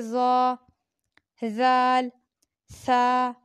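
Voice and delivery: ज़ा ज़ाल सा said gently, with the tongue tip between the teeth; a gentle soft-letter take; said softly. Shown as a demonstration. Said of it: "नरमी से पढ़े".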